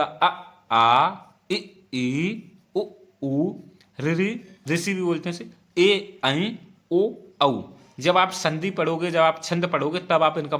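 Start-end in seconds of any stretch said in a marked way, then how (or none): 0.0-7.6 "अ, आ, इ, ई" recited slowly, each vowel sound spoken clearly and separately; pure recitation of vowels. Shown as a demonstration. Hindi teacher reciting vowels for students, clear and measured. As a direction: chant each vowel cleanly as a model for the class. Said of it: "पढ़ने के क्या तरीका होता?".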